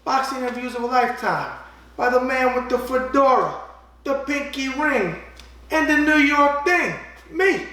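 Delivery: loud, high pitchman read. Acted. Host pitching his book to viewers, loud pitchman voice. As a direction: sell the book like a late-night ad.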